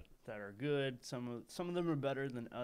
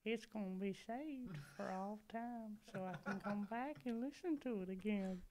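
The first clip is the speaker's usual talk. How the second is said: put-on old man from Family Guy; 8 semitones higher than usual. Luring the paperboy to the basement, high old-man voice. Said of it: "old man from Family Guy".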